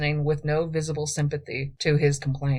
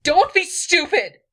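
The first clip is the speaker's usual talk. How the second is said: loud, much higher angry snap; snapping; 14 semitones higher, 8 dB louder than usual. A narrator's reading. Angry queen snaps at her thief, shouting. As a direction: snap it at him. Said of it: "snapped Edis".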